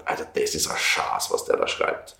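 whispered thought, low and hushed; awed whisper to himself; inner whisper. Retold or imitated. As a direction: breathe it out as a private amazed thought. Inner thought while reading book.

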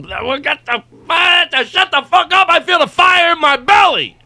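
high, ranting character voice; hammed-up high-pitched outburst; hyped-up radio bit. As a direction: rant like an overexcited show host. Voices a show's overblown catchphrases.

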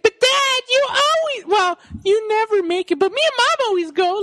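very high, put-on child voice; imitated whining kid. Host as Jamie complaining to dad, mock whine. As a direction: whine up high like a let-down son. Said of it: "that's when he's like".

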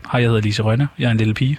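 ordinary delivery

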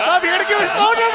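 fast, high, panicked; alarmed high yelp. Real, not acted. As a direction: blurt it out in a panic. Panics at number read out.

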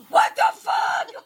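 very high, loud, slow mock shriek; shocked shout. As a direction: yell it stretched out in re-enacted horror. Brother rushing to the stairs, shocked.

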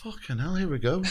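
high-pitched, exasperated quote; mock exasperation. Acted reaction to mum's conspiracies.

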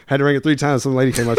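ordinary talk, nothing marked

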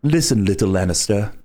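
low mysterious prince voice, rolling R's; rolled r. Shown as a demonstration. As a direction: purr it out, rolling every R. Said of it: "here's a quote that he would do".

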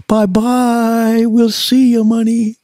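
high sing-song mock goodbye; mock farewell. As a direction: wave the money off in a sneering sing-song. Mocking label's farewell to money.

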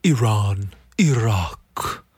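"Iran" as ee-RAHN in a put-on snooty voice; hammed-up posh pronunciation; mock snooty. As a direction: lampoon the snooty crowd saying ee-RAHN. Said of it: "super snooty about it".

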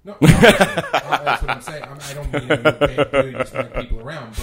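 loud laughing protest. Real, not acted. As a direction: blurt it out while laughing hard. Laughing protest after "I'm terrible.

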